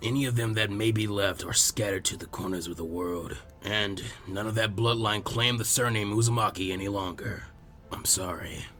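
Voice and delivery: put-on gentle, low old village elder; old Hokage. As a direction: speak like a patient elderly leader. Hiruzen voiced lower.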